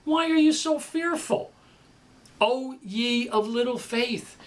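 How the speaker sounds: Jesus rebuking. Acted. Jesus asking fearful disciples, mildly chiding. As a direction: ask it like a puzzled teacher. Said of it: "But then he said to them".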